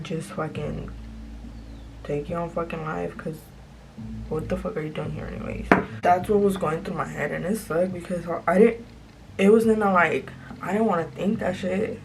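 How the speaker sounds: ordinary delivery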